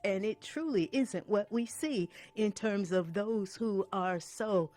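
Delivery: high, loud, forceful sermon delivery; impassioned preaching. Real, not acted.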